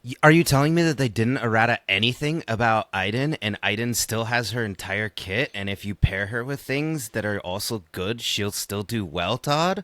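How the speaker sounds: mock-incredulous question, fast start, rising on the final name; sarcastic. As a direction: feign astonishment with cheeky sarcasm. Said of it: "I sense sarcasm in your voice".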